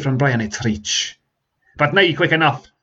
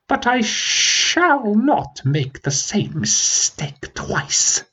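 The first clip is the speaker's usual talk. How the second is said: much higher, very slow, forced calm; dragon straining to stay calm; 11 semitones higher, much slower than usual. Acted. Dragon warning the prince, slow strained calm. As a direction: keep the fury under the lid, drawn out. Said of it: "straining to stay calm".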